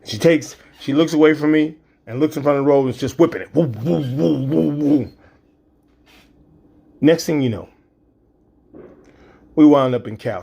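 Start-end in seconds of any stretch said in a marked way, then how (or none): 3.5-5.1 imitated whooshing. Daughter whipping through traffic.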